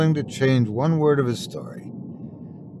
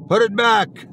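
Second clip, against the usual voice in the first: roaring, much higher and louder; demonic bellow; 10 semitones higher, 6 dB louder than usual. Acted. A ghostly figure bellows at Ray from the bed, roaring. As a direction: bellow it loud enough to wake the dead. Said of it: "rose like a mummy from the tomb and bellowed".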